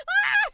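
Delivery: high, loud burst of "Ah!" in awe; overwhelmed cry of delight; excited yell. Retold or imitated. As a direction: let out a sudden thrilled shout. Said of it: "I was like".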